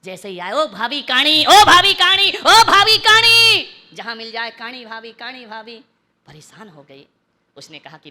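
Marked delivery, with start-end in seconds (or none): 0.5-3.7 high, loud calls, building each time; mock catcall, last one drawn out; teasing shout. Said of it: "भाभी समझी फिर काणी चिल्लाएगा". 4.5-5.8 offhand taunt. Acted. Same taunt repeated more quietly.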